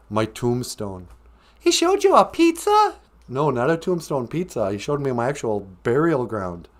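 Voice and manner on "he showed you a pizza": high squeaky confused wife. Acted. Wife mishearing tombstone as pizza, squeaky.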